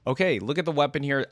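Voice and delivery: usual speaking voice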